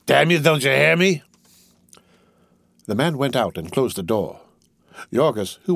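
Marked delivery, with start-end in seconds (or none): 0.0-1.2 higher, irritated outburst; young master losing his temper; angry cry. A narrator's reading. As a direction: scold a servant who won't obey. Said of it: "cried the youngster angrily".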